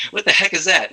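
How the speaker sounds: mock incredulous. Storyteller quoting an irritated boss at a meeting, mock incredulous. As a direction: retell someone's baffled reaction. Retold boss baffled by a name.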